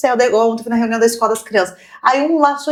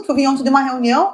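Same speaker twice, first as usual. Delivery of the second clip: ordinary delivery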